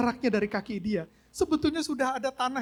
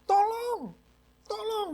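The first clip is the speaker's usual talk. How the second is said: high desperate calls of Tolong; shouting for help; 12 semitones higher, 5 dB louder, much slower than usual. Retold or imitated. Man hanging in the dark calls out, high desperate shout. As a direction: call out as if no one can hear. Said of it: "dia teriak-teriak".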